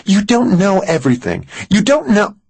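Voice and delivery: very high, heated woman's voice; exasperated Mary. A narrator's reading. Mary argues with reasonable Max, exasperated. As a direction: argue back in a high, upset pitch.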